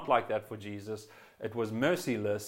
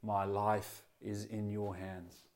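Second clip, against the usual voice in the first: tender trust; 2 semitones lower, 5 dB quieter, slower than usual. Retold or imitated. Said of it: "Jesus said".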